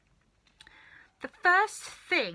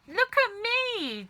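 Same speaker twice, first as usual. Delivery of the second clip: much higher, slow, loud shout; Grandma shouting; 13 semitones higher, 5 dB louder, slower than usual. A narrator's reading. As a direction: demand attention from the roof. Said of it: "Grandma shouted from the rooftop".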